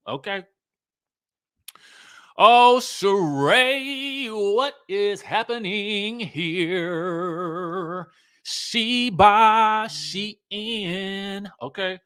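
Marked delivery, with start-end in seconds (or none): 2.4-11.5 drawn-out, very slow lament; slow theatrical "Oh, Sheree", voice pitched up; dramatic dismay. Real, not acted. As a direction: stretch every word in mock despair.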